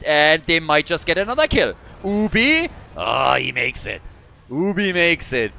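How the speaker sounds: loud, rising hype as the chase peaks; tense excited call. Real, not acted. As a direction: shout the close escape play-by-play. Calling a narrow escape live.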